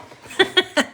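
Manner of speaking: short high giggle; high laugh. Real, not acted. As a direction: giggle at own joke. Joke about working out.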